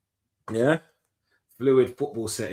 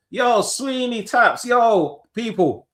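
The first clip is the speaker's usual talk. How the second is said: hype shout-out; 10 semitones higher, 3 dB louder, much slower than usual. Real, not acted. Shout-out to plumber mate.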